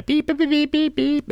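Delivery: high beeping car horn; honking car impression. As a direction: chirp the beeps high. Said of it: "do a better version of the honk".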